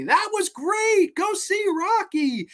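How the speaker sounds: high, slow put-on old man voice; grandfather's voice. Grandfather raving in the New York street, high and slow. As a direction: gush like a moved old man.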